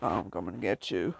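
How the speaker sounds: very low, quiet mock menace; hushed threat. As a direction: creep up on them with a low, soft threat. Stalking the enemy in game.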